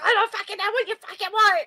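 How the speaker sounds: high-pitched exasperated imitation; hammed-up retold yelling; angry mock outburst. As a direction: explode as the fed-up husband. Acting husband's furious reply.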